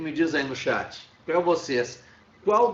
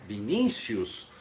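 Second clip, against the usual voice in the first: Vinícius slowly, vi-NÍ-cius; slow demonstration of Vinícius, NÍ stressed; 4 semitones lower, slower than usual. Shown as a demonstration. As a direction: lean long on the middle I of Vinícius. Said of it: "É um I também".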